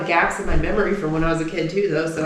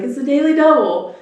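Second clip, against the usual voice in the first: put-on high, loud kid quizmaster; little boy announcer; 8 semitones higher, 6 dB louder than usual. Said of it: "he'll be like".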